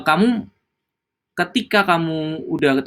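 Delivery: usual speaking voice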